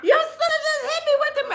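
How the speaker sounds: high, loud mock shriek of panic. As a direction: shriek high and fast like he's running away. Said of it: "screaming like [name]".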